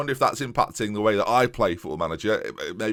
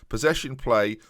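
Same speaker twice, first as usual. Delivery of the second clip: usual speaking voice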